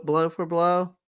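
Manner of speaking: ordinary talk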